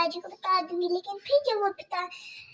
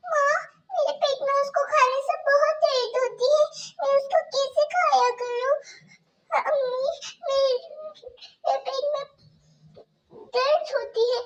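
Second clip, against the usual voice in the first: put-on much higher, small pleading girl voice; pleading young girl; 7 semitones higher than usual. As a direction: Kajal begging her stepmother about stomach pain. Kajal pleads stomach hurts.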